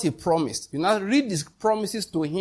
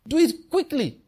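urgent exhortation; 10 semitones higher than usual. Acted. Urging the congregation.

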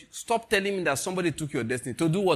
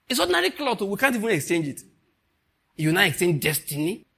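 ordinary talk

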